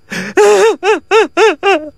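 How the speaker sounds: high drawn-out fake sob; a long put-on wail; mock wailing.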